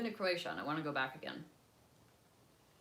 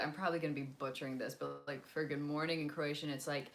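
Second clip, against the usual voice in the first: usual speaking voice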